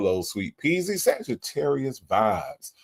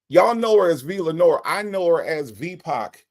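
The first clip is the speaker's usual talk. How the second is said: MC introduction; 6 semitones higher than usual. Acted. Announcing co-host like an MC.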